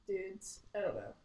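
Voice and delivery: normal talking voice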